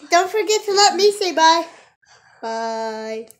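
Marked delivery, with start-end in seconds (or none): 2.4-3.3 low mock ninja voice; lightly voiced ninja goodbye. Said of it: "Ninja voice".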